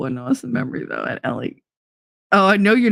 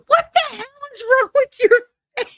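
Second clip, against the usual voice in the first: very high, stunned shriek of disbelief; horrified surprise. Retold or imitated. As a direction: react like her face just turned into a cat's. Shocked at mother's face in sunlight.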